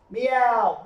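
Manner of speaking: high, loud cat cry; loud meow. Acted. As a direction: sing out the meow on cue. Animals' chorus on cue.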